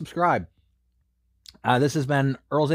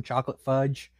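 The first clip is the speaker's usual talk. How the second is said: normal talking voice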